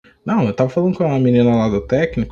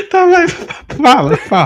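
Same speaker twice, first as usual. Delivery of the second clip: laughing; 3 dB louder, slower than usual. Real, not acted. Begging through laughter to hear nickname.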